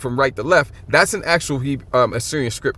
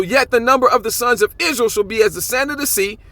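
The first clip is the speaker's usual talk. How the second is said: measured, higher reading of the verse; scripture reading; 7 semitones higher than usual. Acted. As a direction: read it like a lesson text. Reads scripture aloud.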